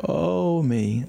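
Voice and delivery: long high moan that falls away; drawn-out pained groan, sinking at the end. Retold or imitated. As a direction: groan it out like an aged man in pain. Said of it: "And Lester would go".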